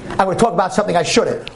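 usual speaking voice

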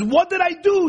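shrill parody of the donkey's plea; mocking squeal. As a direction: squeal the question in mockery. Donkey's plea as Bilam hears it.

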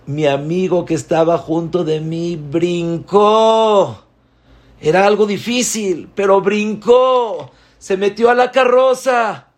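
put-on high, loud and breathless as the boy leaps; excited telling. A narrator's reading. Preacher describing the boy leaping onto the king's carriage, high and loud.

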